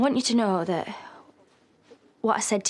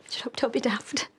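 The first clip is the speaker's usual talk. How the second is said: tearful denial; 5 semitones higher than usual. Acted. You crying?.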